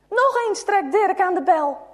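ordinary delivery, nothing marked

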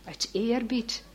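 plain reading voice

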